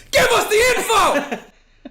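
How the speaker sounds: very high, loud, drawn-out yell; hammed-up interrogator's shout, half laughing; mock interrogation. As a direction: scream the demand slowly, in jest. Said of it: "it's just a three-minute interrogation".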